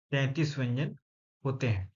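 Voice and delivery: ordinary delivery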